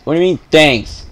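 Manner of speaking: ordinary talk, nothing marked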